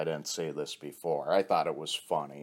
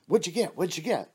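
eager asking; 11 semitones higher, 3 dB louder than usual. Retold or imitated.

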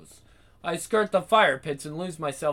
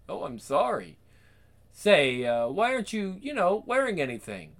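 woman driver voice; 6 semitones higher than usual. Acted.

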